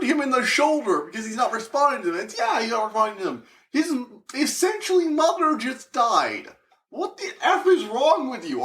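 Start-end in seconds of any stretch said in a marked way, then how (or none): 3.7-8.6 high, incredulous outrage with a laugh; can't believe what they did; outraged. Real, not acted. Ranting at the cops about Aunt May, outraged.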